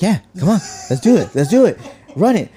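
hyped up, can't wait to go; eager yes. Retold or imitated.

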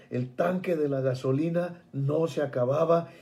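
usual speaking voice

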